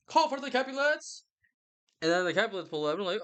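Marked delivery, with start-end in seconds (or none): 0.1-1.2 high-pitched mock proclamation; commanding prince. Prince summoning the family after a brawl, mock commanding. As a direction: call out a royal summons in a put-on high voice.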